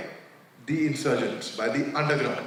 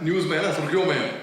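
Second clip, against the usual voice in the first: ordinary talk, nothing marked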